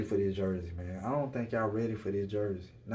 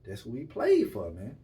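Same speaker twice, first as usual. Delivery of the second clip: ordinary talk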